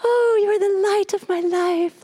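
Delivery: swooning husband. Retold or imitated. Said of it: "he was like".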